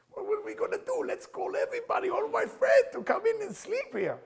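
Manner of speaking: very high, squealing mock excitement; imitated excited outburst. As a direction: act out a high-pitched, over-the-top outburst.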